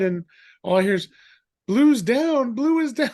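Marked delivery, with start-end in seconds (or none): 1.7-3.1 high, loud call to the others that someone fell; imitated alarm call. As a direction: call it out across the warehouse, pitched way up. Coworker calling out the fall.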